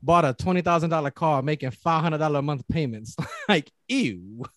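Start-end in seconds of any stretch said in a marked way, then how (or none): 3.3-4.4 high, laughing through the words; pained joke breaking into laughter. Real, not acted. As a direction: crack up while telling your own painful story. Joking about own painful car payments.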